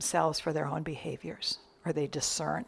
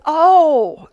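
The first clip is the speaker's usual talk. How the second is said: higher, much louder exclamation; loud surprised oh; 8 semitones higher, 14 dB louder than usual. Retold or imitated. Minister catching on to the singer's excuse, loud surprised oh. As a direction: burst out with a knowing oh.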